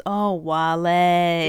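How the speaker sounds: very slow, drawn-out anchor imitation; laughing, drawled mock newsreader; news anchor impression. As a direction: mimic a news anchor stretching out the words. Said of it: "the news anchor guy, but he goes".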